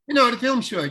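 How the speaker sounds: retelling the director explaining, mildly excited; eager insistence. Retold or imitated. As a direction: push the point home with excitement. Director insisting on film short.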